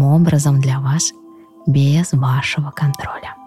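soft, slow meditation voice; calm guide talking them into rest; guided meditation. Acted. Leads a guided breathing meditation.

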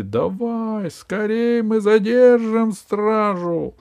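высокий громкий подбадривающий крик; кричать беглецам, подгоняя их. Acted. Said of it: "а многие кричали". (толпа кричит беглецам, высоко и громко)

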